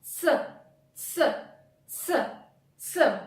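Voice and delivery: a repeated voiceless ts hiss; hissed ts sound. Shown as a demonstration. Teacher drilling the ts sound for learners, voiceless. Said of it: "then the sound s itself".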